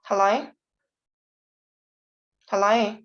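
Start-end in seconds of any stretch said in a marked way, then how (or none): none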